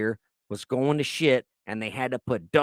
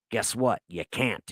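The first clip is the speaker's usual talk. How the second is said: ordinary talk, nothing marked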